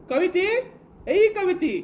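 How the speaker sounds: loud, high summoning shout; angry calling out. Acted. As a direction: yell for your daughter to come. Angry father summons his daughter.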